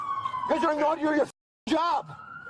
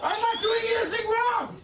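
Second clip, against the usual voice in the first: shouted protest, much higher; angry shouting; 9 semitones higher than usual. Real, not acted. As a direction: yell back in protest. Man refuses, arguing with police.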